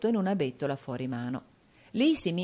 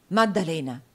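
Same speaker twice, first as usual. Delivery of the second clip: louder echo of Maddalena in surprise; startled exclamation; 5 dB louder than usual. A narrator's reading. Newman hearing the young lady's name, startled exclamation. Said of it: "esclamò Newman".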